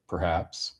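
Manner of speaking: normal talking voice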